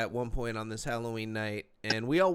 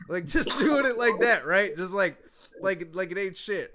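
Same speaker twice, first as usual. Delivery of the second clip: laughing; 7 semitones higher, 6 dB louder than usual. Real, not acted. Laughing at coke in front of cops.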